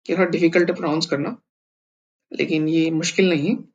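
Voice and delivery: ordinary talk, nothing marked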